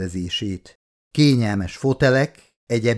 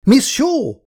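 much higher, louder commanding call; sharp shout; 5 dB louder than usual. A narrator's reading. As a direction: snap her name to stop her. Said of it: "kiáltott rá Meredith Vickers erélyesen".

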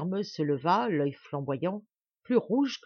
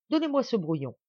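higher-pitched firm demand; commanding; 6 semitones higher, 3 dB louder than usual. A narrator's reading. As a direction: order it handed over. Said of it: "commanda-t-il". (ordre ferme et impatient)

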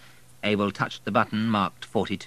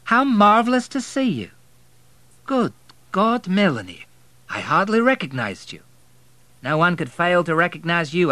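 lightly raised, lively character voices in dialogue; Melanie and Abel dialogue; 9 semitones higher, 7 dB louder than usual. A narrator's reading. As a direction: lift the voice for the characters.

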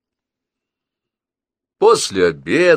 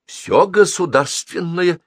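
slow, higher-pitched patient explaining; patiently drawn-out answer; 12 semitones higher, 5 dB louder, slower than usual. A narrator's reading. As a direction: spell it out slowly for a persistent guest. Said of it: "терпеливо говорила бабушка".

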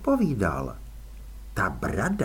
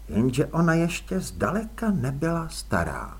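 usual speaking voice

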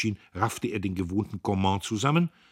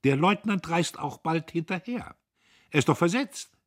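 high, gloating and a bit louder; savouring the bad news; triumphant; 11 semitones higher, 6 dB louder than usual. A narrator's reading. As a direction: rub it in with relish.

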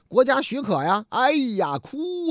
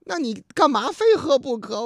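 normal talking voice

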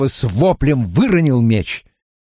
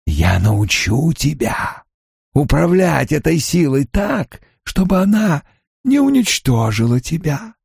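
dark lord; 6 semitones higher than usual. Acted. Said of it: "настойчивый голос".

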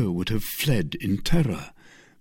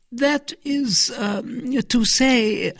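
high, halting and hesitant; stammering; much slower than usual. A narrator's reading. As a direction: stall for words, flustered. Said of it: "stammered Milady".

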